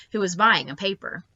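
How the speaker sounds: ordinary delivery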